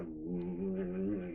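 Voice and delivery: low, soft seething "mm"; low growl. Retold or imitated. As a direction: imitate holding back a punch with a growl. Friend growls, fist cocked.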